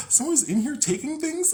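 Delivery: hammed-up shock at the break-in; mock shocked disbelief. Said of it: "I was like".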